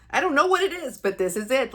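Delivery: sudden high, loud burst of elation; bursting with joy. Retold or imitated. Reliving her blissful drive for viewers, elated burst. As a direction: let the joy spill out, then settle.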